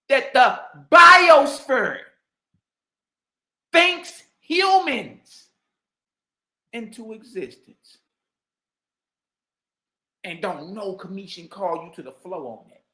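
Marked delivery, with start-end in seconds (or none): 0.1-8.0 slow, loud, higher mock recital. Daring the scholar to repeat his claim, loud and slow. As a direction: stretch every word to ridicule it. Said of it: "fix your mouth and say".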